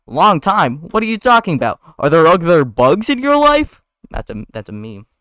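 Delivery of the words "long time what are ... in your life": loud, high, incredulous demand; acted jealous outburst; incredulous anger. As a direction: fire back jealous questions, climbing higher. Ken jealous, acted quarrel.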